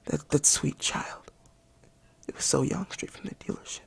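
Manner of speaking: faint, broken whisper of mock mourning; grieving whisper. Reviewer mourning a new car like a child, whispering. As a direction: whisper it as if too choked up to speak.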